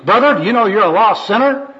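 loud, high-pitched preaching challenge; zealous street evangelist. Acted. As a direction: confront a sinner with loud evangelistic zeal. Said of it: "This is the first personal worker".

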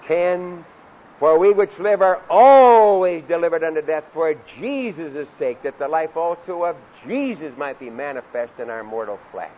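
loud preacher with long-stretched key words; forceful preaching. Real, not acted. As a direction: drive the scripture home, punching the big words. Preaches scripture at full force.

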